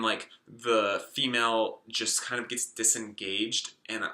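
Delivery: normal talking voice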